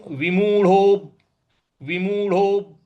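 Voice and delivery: विमूढ़ो slow with the ब pressed; stress on b. Shown as a demonstration. Teacher demonstrating the stressed ब. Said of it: "ब है उस पर हमको बल देना है".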